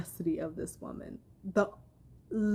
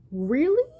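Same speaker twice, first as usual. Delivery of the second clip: sharp incredulous rise, higher and louder; 7 semitones higher, 4 dB louder than usual. Real, not acted. Ranting vlogger scoffing at the abuser's-room visit, incredulous. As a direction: scoff in open disbelief.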